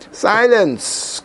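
sharp high "Silence!" cut off; stern reprimand. Acted. Pious self silencing inner voice, stern reprimand. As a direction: snap the order to silence a small voice. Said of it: "came the reprimand".

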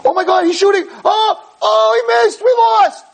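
very high-pitched shouted play reaction; frantic fan. Retold or imitated. Friend watching the taped game, frantic shouting. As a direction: shriek from hope into dismay. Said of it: "He's watching, he's like".

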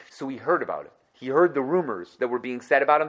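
ordinary delivery

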